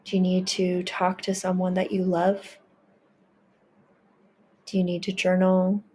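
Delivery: ordinary talk, nothing marked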